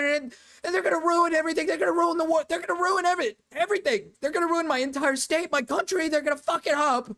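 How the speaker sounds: mock doomsayer. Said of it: "It's always like".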